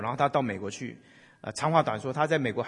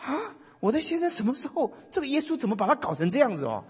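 baffled wife; 10 semitones higher than usual. Retold or imitated. Wife shocked at husband crying.

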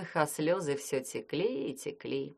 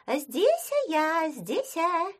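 much higher squeaky answering voice; squeaky fake girl voice; 12 semitones higher, 4 dB louder, much slower than usual. Werewolf as Snegurochka answers.

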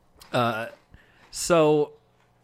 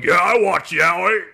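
loud, drawn-out mock-militant yell; militant shout; 3 semitones higher, 6 dB louder, slower than usual. Militant fans at an anime panel, militant shout. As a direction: bellow it like a militant activist. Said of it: "very militant lesbians going".

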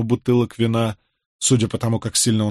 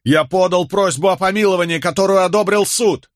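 desperate plea; 8 semitones higher, 4 dB louder than usual. A narrator's reading. Condemned man pleads with crowd.

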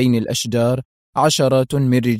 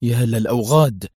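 indignant mutter. A narrator's reading. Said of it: "تمتم حسام في سخط".

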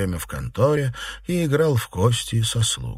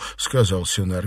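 usual speaking voice

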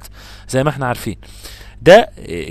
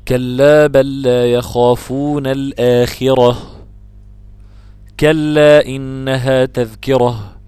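measured, drawn-out tajweed chant; slow Quran recitation; 6 dB louder, much slower than usual. Shown as a demonstration. Teacher recites the verse for the student, in slow chant. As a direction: recite slowly and fully, holding each vowel. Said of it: "Quran teacher reciting verses".

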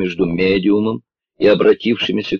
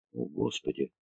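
much softer, shaky whispered gasp; trembling whisper; 3 semitones lower, 14 dB quieter than usual. A narrator's reading. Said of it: "дрожащим голосом прошептала".

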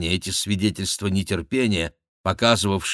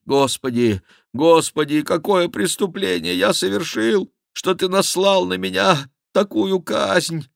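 higher, imploring monk's prayer; pleading lament; 10 semitones higher than usual. A narrator's reading. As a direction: plead upward, rising in pitch. Monk's silent plea to heaven. (жалобная мольба монаха к небесам)

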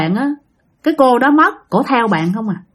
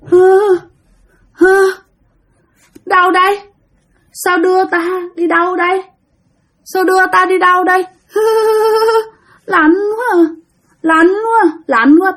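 high, droning ghost speaking through grandma, disoriented and shivering; possessed grandmother; 8 semitones higher than usual. Retold or imitated. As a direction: intone it high, like a lost spirit. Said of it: "tự nhiên bà nội nói giọng khác luôn".

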